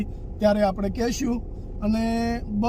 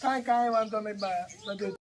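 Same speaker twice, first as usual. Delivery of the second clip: gentle consoling; 8 dB quieter than usual. Real, not acted. Visitor comforts a crying elderly woman, softly coaxing. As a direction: soothe her like calming a grandmother.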